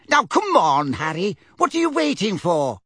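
Mrs Figg urging. A narrator's reading.

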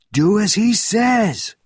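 high, loud, slow command; roared order. A narrator's reading. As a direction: roar it with every word drawn out. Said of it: "roared Benjamin's voice".

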